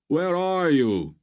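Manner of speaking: high, slow, loud call. A narrator's reading. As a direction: shout across a noisy wharf. Calling across the crowd.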